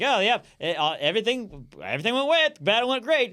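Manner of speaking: high, stumbling mock-breezy report; evasive cheer. Messenger dodging David's question about Absalom, brightly evasive. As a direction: dodge with hesitant, high-pitched cheer.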